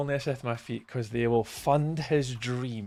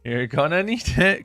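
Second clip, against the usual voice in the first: mock ominous warning; 7 semitones higher, 7 dB louder, slower than usual. Real, not acted. Taunting friend Brandon about the game.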